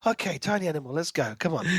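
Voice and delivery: ordinary talk, nothing marked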